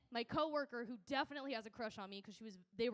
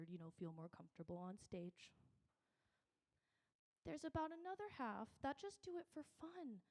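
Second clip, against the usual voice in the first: sweet ethereal teacher; 3 semitones lower, 6 dB quieter than usual. Retold or imitated. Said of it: "he goes in his, like, sweet ... ethereal".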